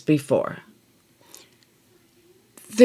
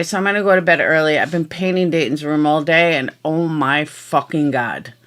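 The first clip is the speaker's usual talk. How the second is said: ordinary talk, nothing marked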